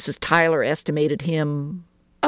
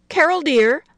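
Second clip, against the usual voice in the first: high, light singsong call to a daughter; called lightly; 12 semitones higher, 5 dB louder than usual. A narrator's reading. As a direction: call her over sweetly and lightly. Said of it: "she called lightly".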